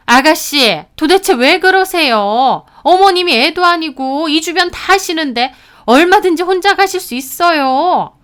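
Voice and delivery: high, loud, exasperated retort; exasperated protest. A narrator's reading. Sister-in-law excusing herself to the sister, exasperated protest. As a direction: argue back in exasperation.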